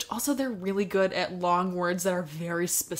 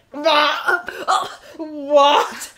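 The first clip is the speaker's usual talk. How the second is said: hammed-up stunned "what", raised and loud; mock shock; 4 semitones higher, 6 dB louder than usual. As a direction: act out someone's disbelief, pitched up. Acting a wrestler's stunned reaction.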